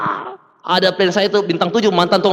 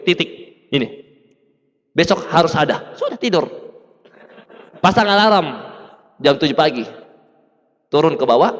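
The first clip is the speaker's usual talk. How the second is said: ordinary talk, nothing marked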